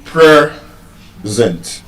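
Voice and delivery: PRE, pause, zent, strong first half of present; stress on first syllable. Shown as a demonstration. Teacher repeating the noun for the class, first-syllable stress. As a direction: present broken in two, PRE punched. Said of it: "As a noun".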